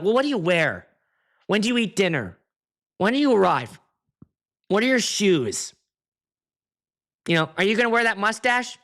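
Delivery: nagging bridezilla. Retold or imitated. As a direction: pepper them like a fussy client. Imitates bridezilla's barrage of questions.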